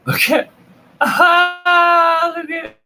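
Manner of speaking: high squeaky laughing; cracking up mid-sentence. Real, not acted. Laughing at funny picture.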